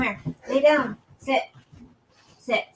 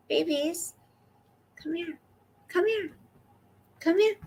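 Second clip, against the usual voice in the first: high, soft sing-song calling; 7 semitones higher, 8 dB quieter than usual. Real, not acted. Owner calling her dogs over in high baby talk.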